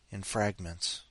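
normal talking voice

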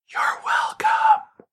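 whispered, drawn-out and slow; breathy whisper. Acted. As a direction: breathe it out slowly. Teasing whispered payoff.

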